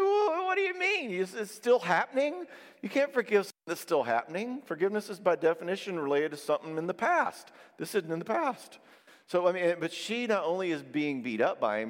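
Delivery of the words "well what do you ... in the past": high-pitched, astonished objection; incredulous protest. Retold or imitated. Counselor to woman still being yelled at, incredulous. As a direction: react in raised-pitch disbelief, then argue it. Retelling his astonishment at her answer.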